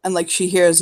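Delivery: normal talking voice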